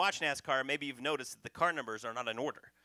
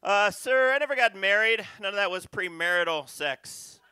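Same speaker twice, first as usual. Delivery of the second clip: pleading dead man; 5 semitones higher, 7 dB louder than usual. Acted. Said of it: "the angel would say".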